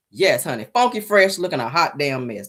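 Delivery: usual speaking voice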